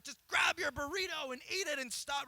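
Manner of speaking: high, loud mock-fed-up command; fed-up order. Dad retelling ordering his son to eat, mock fed-up shout. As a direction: snap it out like a worn-out parent.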